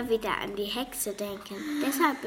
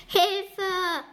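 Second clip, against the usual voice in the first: a high, loud cry for help; scream for help; 10 semitones higher, 6 dB louder than usual. A narrator's reading. Leonie screams as the strange creature appears, a high loud cry. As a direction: shriek it out in fright. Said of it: "schrie Leonie laut auf".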